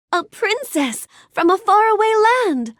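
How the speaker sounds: high, excited exclamation; thrilled, lifted storybook wonder; delighted wonder. Acted. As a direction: marvel aloud at a fairy-tale guest. Delighted at meeting a princess.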